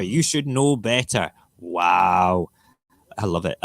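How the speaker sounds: usual speaking voice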